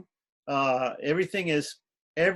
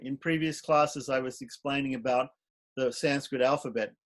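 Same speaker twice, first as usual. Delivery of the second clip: ordinary talk, nothing marked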